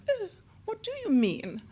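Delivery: mock-puzzled, pitched well up; puzzled high oh. Auditioner puzzled by her wig remark, high surprised voice. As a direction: play the baffled auditioner asking what she means.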